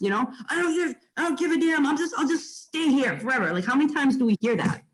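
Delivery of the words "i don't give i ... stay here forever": high-pitched mock exasperation; imitating stressed client. Imitating a stressed seller threatening to stay put, mock exasperated. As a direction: put on a client's fed-up outburst. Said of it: "they get so freaking stressed".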